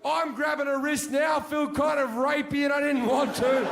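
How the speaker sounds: mock-panicked shouting tale; frantic loud retelling. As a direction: yell the chaos out as it builds. Frantic retelling of car fight.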